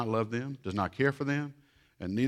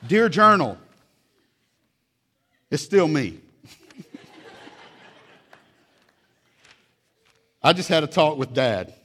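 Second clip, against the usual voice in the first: put-on loud, higher kid's complaint; hammed-up child voice; mock indignant child; 3 semitones higher, 8 dB louder than usual. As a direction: read it as the sulking kid. Child's second entry, loud.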